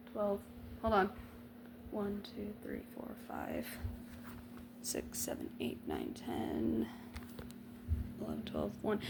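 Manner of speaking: very soft mumbled counting; counting under breath. Real, not acted. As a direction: count quietly to yourself, working it out. Counting hours to herself.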